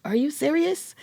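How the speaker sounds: high, amazed question; high disbelieving gasp of a question; stunned disbelief. Retold or imitated. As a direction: ask it like you can't believe your ears. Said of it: "I even walked up there like".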